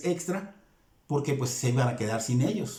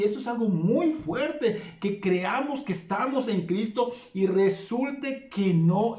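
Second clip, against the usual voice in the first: fervent preaching; 4 semitones higher than usual. Acted. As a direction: press the point hard on the flock. Preaching at full force.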